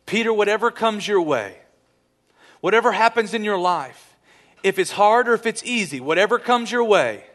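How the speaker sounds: loud, high preaching declamation; emphatic charge. Retold or imitated. Jesus charging Peter for whatever comes, loud emphatic exhortation. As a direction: proclaim each clause with rising conviction.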